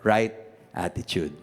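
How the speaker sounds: slow, soft listing of points; slow recap. Shown as a demonstration. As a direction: lay each point down slowly. Recaps points slowly, softly.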